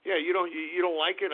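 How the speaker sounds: ordinary talk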